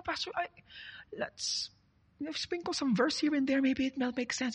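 soft, high, hesitant coaxing; mock confidential persuasion; hushed suggestion. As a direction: sidle up to the pastor with a quiet idea. Acting member coaxing the pastor.

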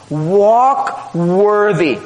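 Walk worthy drawn out very slowly, each word weighted; slow emphasis. Real, not acted. Preacher repeating the verse to the church, very slow and weighty.

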